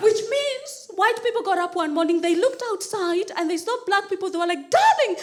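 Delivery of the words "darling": high, loud panicked yelling; hammed-up alarm, urging a partner to flee; frantic mock-terrified shout.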